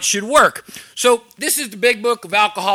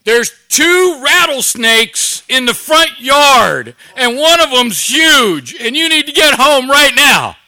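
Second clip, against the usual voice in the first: higher, louder, slow mock panic from his wife; wife panicking about rattlesnakes, urgent; panicked wife; 5 semitones higher, 7 dB louder, slower than usual. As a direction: play the wife's alarm, drawn out and urgent. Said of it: "she's panicked".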